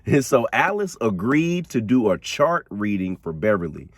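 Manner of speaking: ordinary talk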